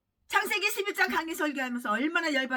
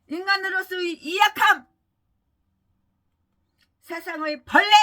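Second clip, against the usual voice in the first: higher, louder preached refrain; preaching refrain; 6 semitones higher, 7 dB louder than usual. Acted. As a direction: proclaim it from the pulpit. Repeated sermon refrain. (설교하듯 힘주어 선포)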